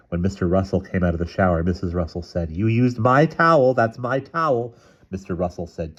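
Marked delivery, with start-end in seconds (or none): 2.5-4.8 quarrelsome accusation. A narrator's reading. Husband and wife quarrel over towel.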